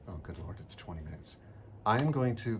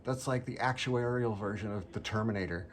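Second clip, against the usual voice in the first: bored scoff; 4 semitones higher than usual. Retold or imitated. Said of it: "And she's like".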